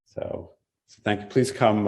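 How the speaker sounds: normal talking voice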